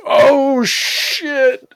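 high, loud, drawn-out exclamation; floored by a movie twist, played up; re-enacted shock. Viewer recalling the scene that got him, re-enacted shock.

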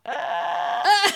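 high, hoarse squeak; squeaky voice. Real, not acted. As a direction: squeak it out with a tired voice. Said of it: "this is my teacher squeaky voice now".

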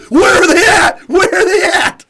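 very high, loud shout breaking into laughter; laughing mock shout. Picturing himself firing from the hip, laughing high shout. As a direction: bellow it like Rambo after emptying the belt. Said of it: "I'm like".

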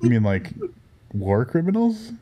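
a joking, pointed question; mock incredulous. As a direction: tease him with it. Deadpan joke reply.

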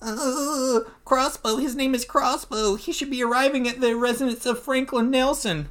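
high, whimpering coward; Barrington the weenie. Acted. Weenie confronted about the assassin, high pleading voice. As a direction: squeal it like a weenie giving up the secret. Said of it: "'cause he's such a weenie".

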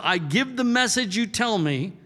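ordinary talk

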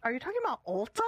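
high, baffled question; puzzled question. Retold or imitated. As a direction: ask it like you can't believe it. Confused reply to Lexi.

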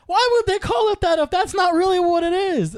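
high, loud imitated whine-yell; insistent sister. Brother imitating his sister stuck on the concept, insistent. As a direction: protest at the top of the voice. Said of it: "she was just stuck on the concept".

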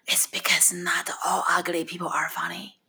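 hushed secretive whisper, mimicking mom; mother whispering her wisdom; mom's whisper. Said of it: "she started whispering".

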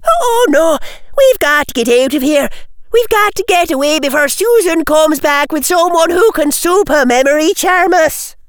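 put-on loud, high girlish alarm; panicked. Tracy warning that Susan will return, panicked. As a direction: urge everyone to flee, voice pitched high.

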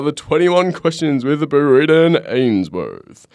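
lightly voiced show announcer, slow. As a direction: present it like a show title.

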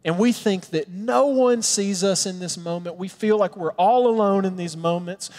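normal talking voice

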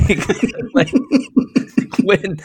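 normal talking voice

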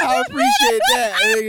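high laughing thanks. Real, not acted. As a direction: thank him through laughter. Laughing at drop.

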